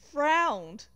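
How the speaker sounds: usual speaking voice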